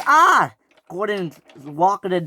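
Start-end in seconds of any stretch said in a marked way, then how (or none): 0.1-0.5 high, loud "ah" of alarm; sudden high yelp; loud cry. Acted. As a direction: cry out as you go hurtling off. Gordon cries out rocketing downhill.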